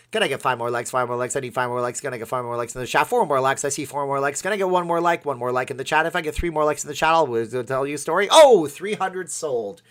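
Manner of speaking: rapid, loud auction chant; auctioneer. Pretend. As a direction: rattle it off like an auctioneer. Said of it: "That was my auctioneer's voice".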